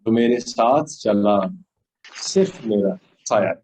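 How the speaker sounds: measured, solemn ghazal delivery; poetry recitation. Acted.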